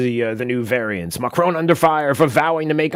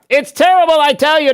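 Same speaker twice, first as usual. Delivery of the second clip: higher and louder, hammed-up dread; mock alarm; 11 semitones higher, 7 dB louder than usual. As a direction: sell fake panic over the new flu. Mock panic over Flurona.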